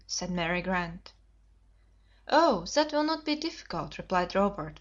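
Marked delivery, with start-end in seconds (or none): 2.3-4.0 put-on boyish confidence. A narrator's reading. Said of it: "with boyish confidence".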